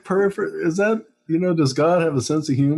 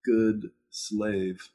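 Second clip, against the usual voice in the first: mock submissive pleading; 4 dB quieter than usual. Begging for rules as a good slave, meekly pleading. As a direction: plead meekly, trailing into a very soft promise. Voicing a fearful, submissive people-pleaser.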